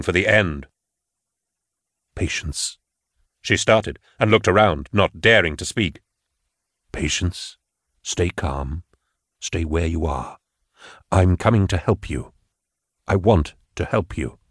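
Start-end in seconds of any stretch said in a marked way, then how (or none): 2.1-2.8 soft, unvoiced call of her name; quiet voice in her mind. Gideon calling softly into Patience's mind, hushed. 6.9-14.4 low, soft telepathic calm; Gideon's psychic voice. Acted. Gideon reassuring Patience telepathically, low and soft.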